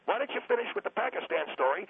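ordinary talk, nothing marked

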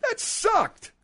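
very high-pitched exasperated verdict; indignant scorn. Real, not acted. Host judging her dingo impression, high-pitched scorn. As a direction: squawk it in disbelief.

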